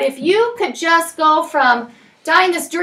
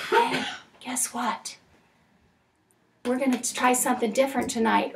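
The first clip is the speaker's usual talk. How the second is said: quietly, much softer, coming in under the noise; soft and low; 2 semitones lower, 10 dB quieter than usual. Shown as a demonstration. Mom showing how to calm fighting kids, soft. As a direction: drop it down gently to calm them. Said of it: "come up underneath them".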